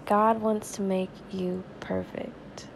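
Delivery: usual speaking voice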